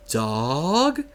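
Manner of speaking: "dog" drawn out with a big upward swoop; mimicked "dog". Teacher teases the student in class, "dog" exaggerated and rising. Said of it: "I'll say it like that".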